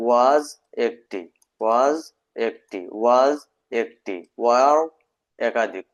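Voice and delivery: slow, stretched repetition; slow repeated drill. Shown as a demonstration. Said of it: "এই দেখেন". (ধীরে ধীরে বারবার বলা)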